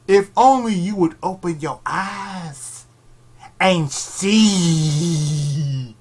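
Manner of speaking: loud, slow hammed-up preacher; mock prophet. Vlogger preaching mock enlightenment at anime fans, hammed up. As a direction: preach it like a self-styled prophet.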